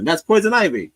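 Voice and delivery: loud, high call-out; shout-out. Real, not acted. Calling out Poison Ivy excitedly.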